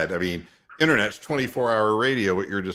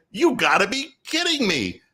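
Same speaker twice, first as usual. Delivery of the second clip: disbelief; 9 semitones higher than usual. Retold or imitated. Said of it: "And, and I say".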